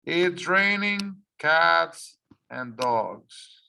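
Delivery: It's raining cats and dogs said slowly, each word marked; teacher slowing down to spotlight an idiom; slow, emphasized idiom. Shown as a demonstration. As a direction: lay the idiom out slowly for the class. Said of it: "bring attention to this here".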